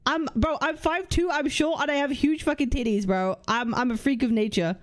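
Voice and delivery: ordinary delivery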